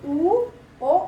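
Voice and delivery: teacher shows pupils the rounded vowels; rounded vowel demo. Shown as a demonstration. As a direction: round the lips and call out "उ, ओ". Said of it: "अब देखिए उ".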